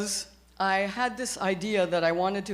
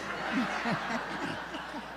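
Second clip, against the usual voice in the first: a soft unvoiced laugh; breathy laugh; 3 semitones higher, 8 dB quieter than usual. Real, not acted. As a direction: laugh at your own punchline. Laughs after punchline.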